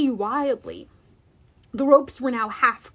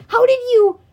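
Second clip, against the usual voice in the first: loud, high, astonished question; gasping amazement; 11 semitones higher, 11 dB louder than usual. A narrator's reading. Grover amazed by fallen giant, gasping question. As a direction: blurt it out in disbelief. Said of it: "Grover gasped".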